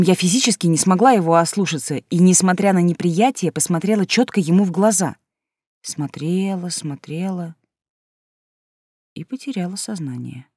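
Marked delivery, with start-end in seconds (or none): 5.8-10.5 softer and softer, trailing off; fading away. A narrator's reading. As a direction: let the voice fade out. Fading into unconsciousness.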